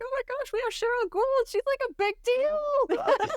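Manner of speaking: giddy high-pitched excitement over a big-name guest; starstruck gushing. Retold or imitated. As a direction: gush like meeting a celebrity. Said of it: "I'm like".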